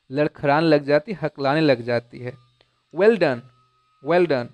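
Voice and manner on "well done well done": louder, bright praise; encouraging. Acted. Teacher praises the stammering girl, encouraging and louder. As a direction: cheer her on warmly. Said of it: "the teacher encouraged her".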